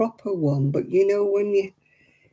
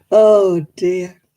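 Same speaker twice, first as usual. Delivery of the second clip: ordinary talk, nothing marked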